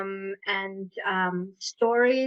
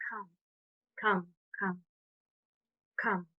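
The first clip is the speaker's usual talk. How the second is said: book calling softly; 4 semitones lower, 10 dB quieter than usual. Retold or imitated. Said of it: "the book calling me".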